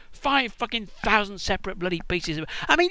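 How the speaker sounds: usual speaking voice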